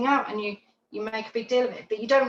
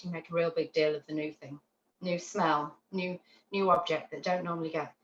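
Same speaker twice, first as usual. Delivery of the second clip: usual speaking voice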